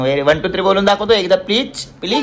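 father coaxing son to count aloud. Real, not acted.

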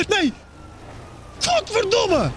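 high exasperated swear; frustrated curse. Real, not acted. Streamer cursing at the game, frustrated outburst.

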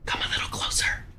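creepy luring whisper; whispering while hiding. Real, not acted. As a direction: whisper to draw them in.

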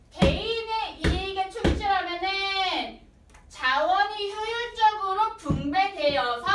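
slow, loud, sing-song stress; emphatic reading. Shown as a demonstration. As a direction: spell out the key claim slowly. Teacher reading key sentence.

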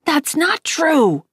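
slow, high, emphatic denial; narrated yell. A narrator's reading. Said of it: "Mom yelled".

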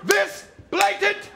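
slow, high furious yelling; angry shout. Acted. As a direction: bellow at them in outrage. Angry boss-style outburst.

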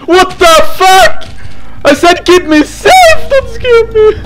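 high, loud scream of fright; real jump-scare yelling; panicked shout. Real, not acted. Jump scare in horror game.

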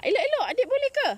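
excited cheering on. Real, not acted. Child lifting coconut, mother encourages.

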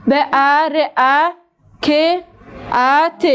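به ا ر ا ک ا ت, each sound said apart with pauses; spelling out sounds. Shown as a demonstration. Teacher sounding out برکت letter by letter.

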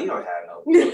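teasing disbelief through a laugh; playful scoff at a kid's claim; laughing mock disbelief. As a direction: laugh it off as nonsense. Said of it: "I'm like".

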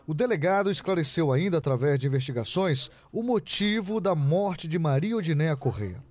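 crisp, emphatic TV reporter read; measured, punchy reporting delivery; news report narration. Acted. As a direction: read it as a crime news voice-over.

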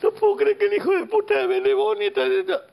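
very high-pitched imitation of weeping; hammed-up sobbing voice, way up high; mock crying. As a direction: wail it like a put-on tearful complaint. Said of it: "pensé que iba a llorar y dice".

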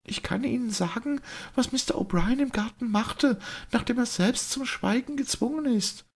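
a brave lady speaking up in public; clear, trembling voice. A narrator's reading. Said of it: "mit jener klaren, zitternden Stimme".

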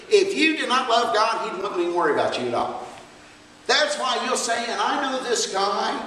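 loud, driving preaching to the church; full-force preaching. Acted. Preacher exhorting the church, loud and driving. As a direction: preach it hard to the congregation.

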